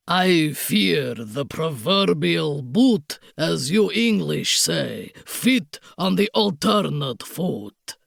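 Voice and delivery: put-on low, slow sneer in thick Bragislanian accent; sneering Count. A narrator's reading. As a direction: sneer as a foreign villain. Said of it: "he sneered".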